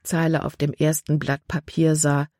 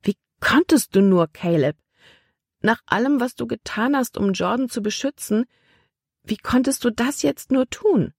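high-pitched, upset reproach; appalled, voice pitched well above narration; indignant reproach; 7 semitones higher than usual. A narrator's reading. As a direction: plead and accuse at once, pitch raised. Libby reproaches Caleb in a quarrel.

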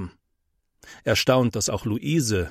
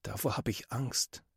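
soft, much quieter admission; barely above a breath, shy and scared; said quietly; 12 dB quieter than usual. A narrator's reading. Scared boy quietly admitting his fear to friends, softly. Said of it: "sagt er leise".

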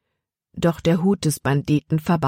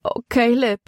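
higher-pitched plaintive lament; a worried, lifted whine; wailing; 6 semitones higher than usual. A narrator's reading. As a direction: moan his name in helpless distress. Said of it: "jammerte Libby".

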